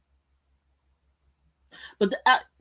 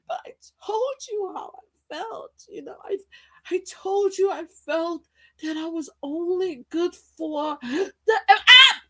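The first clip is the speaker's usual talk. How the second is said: mock crying Jane; 8 semitones higher than usual. Acting Jane, tearful.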